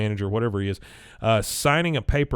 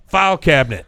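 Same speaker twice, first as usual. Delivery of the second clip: louder, higher sudden answer; imitated blurt; 5 semitones higher, 8 dB louder than usual. Man put on the spot about what he wants, blurting. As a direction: blurt it out after a pause. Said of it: "he just blurts out".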